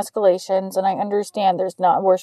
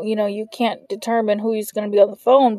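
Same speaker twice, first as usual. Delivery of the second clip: ordinary talk, nothing marked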